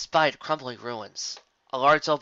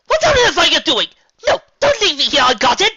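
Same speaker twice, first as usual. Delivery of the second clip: much higher and much louder panicked shout; 13 dB louder than usual. Acted. Rarity shouting at ponies leaving her alone, loud panicked yell. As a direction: yell after the retreating friends. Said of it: "Rarity shouted".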